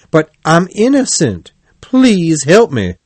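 high-pitched, insistent appeal; desperate pleading. A narrator's reading. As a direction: plead innocence and beg for help. Toad begs driver for help.

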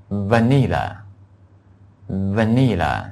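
vanilla, spoken very slowly with a clear V; slow model word. Shown as a demonstration. Teacher modelling the V: slow vanilla. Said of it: "下面一个".

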